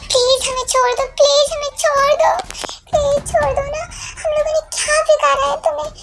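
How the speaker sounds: high, loud begging with a tearful edge; shouted pleading. Acted. Captive begging kidnappers for release, shouting and pleading. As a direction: cry out to be let go. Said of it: "इतना चिल्लाने की क्या जरूरी है?".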